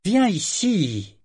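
high, slow, irritated order; impatient drawn-out command; irritated repeat. A narrator's reading. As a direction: repeat the order, losing patience. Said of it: "répéta-t-il, irrité".

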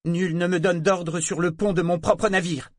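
lancé plus fort et plus aigu; réplique lancée. Acted. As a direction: lance un défi fier. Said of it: "jeta-t-il". (réplique lancée)

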